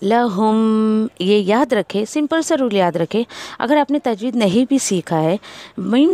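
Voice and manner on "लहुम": लहुम with the म hummed very slowly for two counts; slow nasal ghunna on लहुम. Shown as a demonstration. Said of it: "दो, टू counts only".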